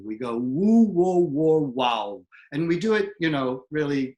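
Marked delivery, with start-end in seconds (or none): 0.4-2.2 WOO, WHOA, WOW with lips pushed far forward; exaggerated lip rounding. Shown as a demonstration. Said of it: "a little exercise I do".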